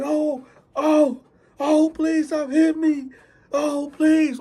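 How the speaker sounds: high, mock pained begging; whimpering plea. Thief begging not to be hit, high and whiny. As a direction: beg him to stop the blows.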